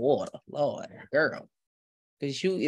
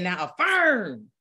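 slow, drawn-out command to affirm; imitating Reverend Ike; 5 semitones higher, slower than usual. Host imitating Reverend Ike commanding the congregation to affirm, slowly. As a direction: stretch it out like a preacher's order. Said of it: "He said".

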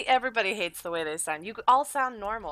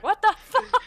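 high, loud mock outburst; hammed-up screamed disbelief; imitated scream; 13 semitones higher, 10 dB louder than usual. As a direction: yell it like a shocked teammate. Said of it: "You hear Stoner screaming at the end".

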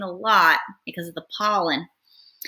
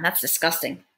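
plain reading voice

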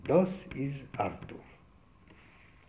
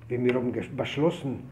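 ordinary delivery

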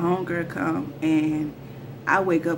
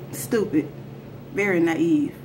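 plain reading voice

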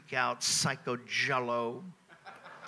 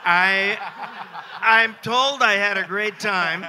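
laughing; 7 semitones higher, 12 dB louder, slower than usual. Real, not acted. Laughing at his own memory loss.

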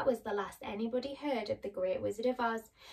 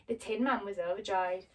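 ordinary delivery

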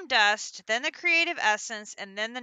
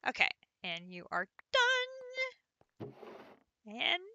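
ordinary talk

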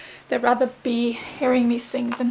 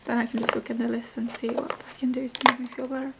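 whispered aside to the camera; 6 dB quieter, faster than usual. Real, not acted. Vlogger whispering her plans to viewers.

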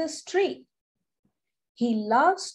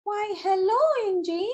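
lightly voiced high tree; a friendly tree greeting; talking tree; 8 semitones higher, faster than usual. A narrator's reading. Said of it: "Pacha the tree says".

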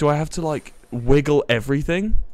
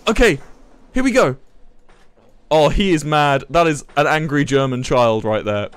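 normal talking voice

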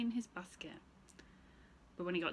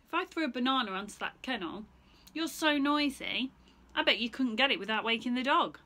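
put-on higher, louder teasing boy; cheeky taunt; 5 semitones higher, 9 dB louder than usual. Stephen teases the monkey, higher and cheeky. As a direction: dare the monkey.

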